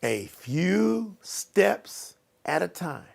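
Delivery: slow, softened, evenly spaced words; slowly. Shown as a demonstration. Coach has listeners repeat a few steps, slowly. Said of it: "Say, say it slowly".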